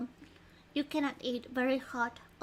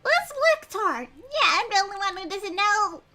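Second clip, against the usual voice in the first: much higher, louder bewildered heroine; high surprised character voice; 6 semitones higher, 12 dB louder than usual. Acted. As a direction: play her as startled and lost. Protagonist's line in game.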